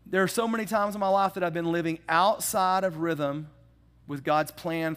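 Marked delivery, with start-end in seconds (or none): none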